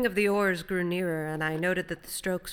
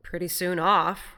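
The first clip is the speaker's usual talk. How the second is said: slow, loud, mildly miffed remark; slightly injured; 8 dB louder, slower than usual. A narrator's reading. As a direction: remark it with a touch of hurt. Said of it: "with perhaps just a little sense of injury".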